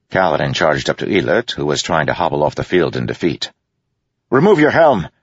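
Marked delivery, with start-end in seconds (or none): 4.3-5.1 loud, high barked order; shouting. A narrator's reading. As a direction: yell the command. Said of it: "Kaladin shouted at him".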